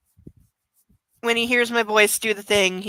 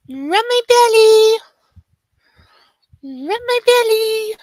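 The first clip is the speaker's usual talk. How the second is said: high, cutesy talking puppy; 7 semitones higher than usual. Pretend. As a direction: speak as an adorable attention-seeking doggy, pitched up. Owner voices the puppy.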